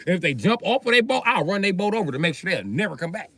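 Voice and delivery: normal talking voice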